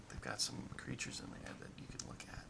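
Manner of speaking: hushed, tempting whisper; soft, conspiratorial enticement; secretive whisper. Acted. Character tempts friends with secret.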